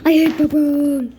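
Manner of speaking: loud burst of mock hatred; sudden loud game rage; exasperated outburst. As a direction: blurt it out loudly in disgust. Outburst about hated purple.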